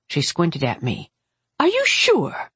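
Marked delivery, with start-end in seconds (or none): 1.6-2.5 very high, piercing aunt's question; suspicious aunt. Acted. Aunt squinting at her niece's explanation, suspicious. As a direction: demand it high, doubting the answer.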